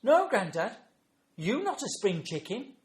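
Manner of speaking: put-on high, loud scornful child voice; imitated small child's utter disdain; disdainful granddaughter. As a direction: put Grandad down like a withering toddler. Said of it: "looked up at me with utter disdain".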